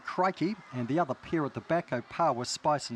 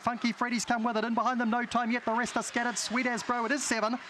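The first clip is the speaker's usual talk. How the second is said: New Zealand race caller, climbing higher; broad kiwi accent; 5 semitones higher than usual. Acted. Race call, kiwi accent noted.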